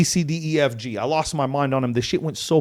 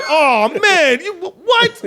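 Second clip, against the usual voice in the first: high, laughing disbelief; laughing shock; 13 semitones higher, 4 dB louder, slower than usual. Retold or imitated. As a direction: blurt it out, pitch flying up while laughing. [name] says good luck.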